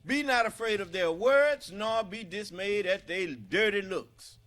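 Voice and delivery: high, loud, full-force preaching cry; rising sermon shout, hammering each phrase; fiery preaching. Acted. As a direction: preach it out to the hall at the top of your voice.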